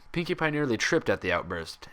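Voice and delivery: ordinary delivery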